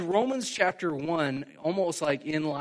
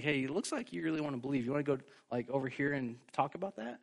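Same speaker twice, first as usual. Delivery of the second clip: soft, quick, hushed aside; mock quiet aside; 8 dB quieter, faster than usual. Preacher imitating Paul taking the man aside, mock quiet aside. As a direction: murmur it privately like a sidebar chat. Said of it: "whisper to the guys".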